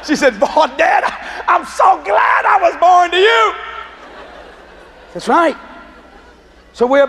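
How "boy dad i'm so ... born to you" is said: high, drawn-out imitation of a delighted daughter; gleeful daughter. Grown daughter to her dad, so glad to be his, gleefully imitated.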